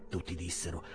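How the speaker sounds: plain reading voice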